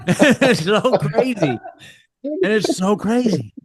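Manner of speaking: high, drawn-out words through laughter; cracking up, stretching the words out slowly; real giggly amazement; laughing in disbelief. Real, not acted. Cracking up at the retold calls.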